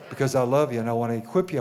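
ordinary delivery

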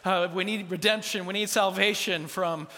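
loud, high plea to heaven; hammed-up begging to God, opening drawn out; mock desperate prayer. Parents praying over the hospital bill, mock desperate. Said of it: "like, just praying".

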